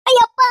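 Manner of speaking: loud high cry of pain. Acted. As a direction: yelp as the blow lands. Wife being hit.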